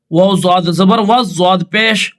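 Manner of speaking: ordinary talk, nothing marked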